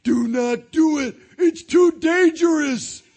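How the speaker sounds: high, loud, slow pleading Patrick; begging. Acted. Patrick begging SpongeBob, high loud slow plea. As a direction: plead with him, loud and drawn out. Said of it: "Patrick ran up to SpongeBob and begged".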